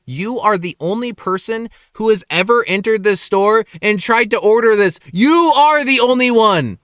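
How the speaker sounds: retold indignation, stretching the last phrase out; exasperated retort. Staff telling off the picky customer, mounting exasperation.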